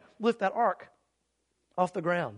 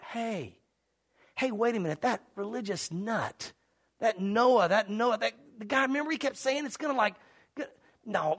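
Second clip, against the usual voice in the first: usual speaking voice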